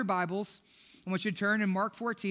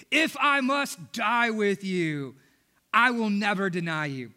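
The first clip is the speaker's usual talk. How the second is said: slow, louder, every word pressed; emphatic vow; 4 dB louder, slower than usual. Retold or imitated. Peter doubling down to Jesus, slow and emphatic. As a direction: swear it slowly like an oath. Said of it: "doubled down and emphatically".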